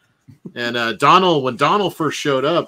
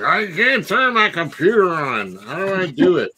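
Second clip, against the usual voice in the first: put-on drunk caller; 6 semitones higher, 4 dB louder than usual. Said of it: "some drunk guy going".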